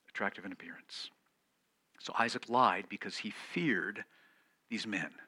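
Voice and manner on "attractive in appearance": whispered repeat. Shown as a demonstration. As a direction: whisper "Attractive in appearance" quietly and quickly. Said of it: "because she was attractive in appearance".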